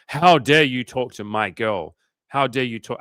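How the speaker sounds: loud, drawn-out indignant challenge; hammed-up, slow, loud indignation; mock outrage. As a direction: imitate an outraged boyfriend confronting a rival. Imitates an angry boyfriend confronting him.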